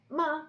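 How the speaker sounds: "ma" high and falling sharply; fourth tone. Shown as a demonstration. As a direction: drop "ma" hard and fast in pitch. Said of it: "very sharp and goes down".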